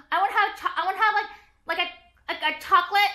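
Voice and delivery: high, childish mock voice; high-pitched mockery of a childish order; mocking immature customer. Mocks annoying, immature frappuccino orderers.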